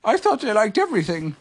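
high-pitched bear voice; Pooh. A narrator's reading. Pooh introduces himself to the stranger, high voice. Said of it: "said Pooh".

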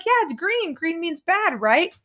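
high, sing-song teasing voice; mock-innocent mom. Mom teasing daughter about green binder, high and playful. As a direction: play dumb in a high teasing lilt. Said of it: "And I'd be like".

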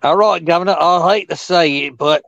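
British drifting to Aussie. Pretend. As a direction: put on a bad British accent and let it wander to Australian. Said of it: "I started off as British and went out to, like, some kinda Aussie".

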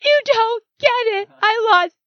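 wife wailing at her calm husband; distraught outburst. Retold or imitated. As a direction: cry it out in frustration. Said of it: "I was like".

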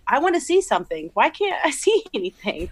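high-pitched, half-laughing frustrated whine; mock exasperated. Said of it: "I was getting so mad".

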